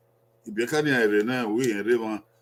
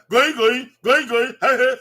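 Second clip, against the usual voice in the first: urging shout; 7 semitones higher, 5 dB louder, faster than usual. Acted. Urging someone to go in.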